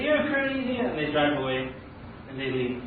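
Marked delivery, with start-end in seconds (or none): 0.0-0.8 high-pitched exasperated dismissal; mock disbelief, pitched high; acted incredulity. Drivers giving up on stubborn man, incredulous.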